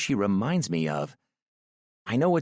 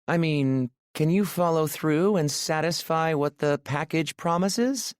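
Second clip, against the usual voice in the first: much higher, playful worried woman; teasing woman's voice; 8 semitones higher than usual. A narrator's reading. Aurora jokes with Maxim about being bad in bed, high and playful. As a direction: tease him in a high voice.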